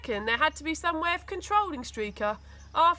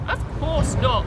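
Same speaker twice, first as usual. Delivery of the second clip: cocky aside; 3 semitones higher, 7 dB louder than usual. A narrator's reading.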